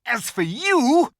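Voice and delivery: slow, loud, high threat; cold witch. A narrator's reading. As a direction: turn on Edmund coldly. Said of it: "said the witch".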